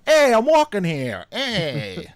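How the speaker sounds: high, loud New York Italian bluster; hammed-up New Yorker in Italian accent; mock New York Italian. Host doing a joke New York Italian accent. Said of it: "New York Italian accent".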